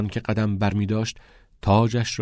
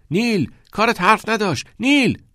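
high, loud cheering shout; loud call to a friend; 13 semitones higher, 5 dB louder than usual. A narrator's reading. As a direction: yell praise over the crowd. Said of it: "تاد داد زد".

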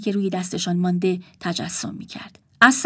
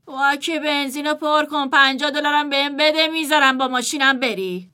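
lazy brother; 5 semitones higher than usual. Acted. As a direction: drag it out, unbothered. Brother bargains lazily.

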